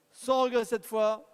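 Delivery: loud, punchy closing call; loud final auction call. Real, not acted. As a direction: bang it out like the gavel falling.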